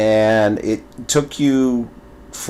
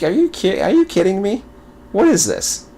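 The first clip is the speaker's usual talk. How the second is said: high, incredulous retold reaction; high-pitched mock incredulity; mock disbelief; 9 semitones higher than usual. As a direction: act out can't-believe-it bafflement.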